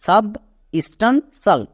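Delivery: সাব, স্টান, সাল set apart by pauses; teacher sounding out substantial in pieces; part-by-part pronunciation. Shown as a demonstration. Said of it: "একসঙ্গে উচ্চারণ করো".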